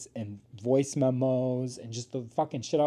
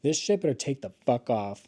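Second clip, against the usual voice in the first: usual speaking voice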